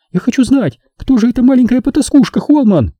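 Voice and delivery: high-pitched indignant woman's voice; jealous outrage. A narrator's reading. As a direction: demand answers like a jealous girlfriend. Jealous girlfriend's furious questioning. (ревнивое возмущение, высокий женский голос)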